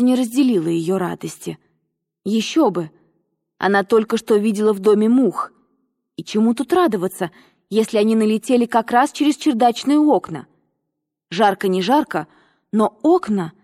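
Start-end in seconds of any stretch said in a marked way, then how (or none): none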